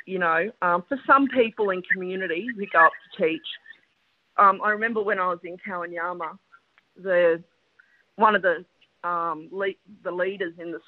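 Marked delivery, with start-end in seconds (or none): none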